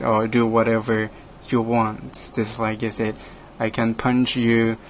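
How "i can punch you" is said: normal talking voice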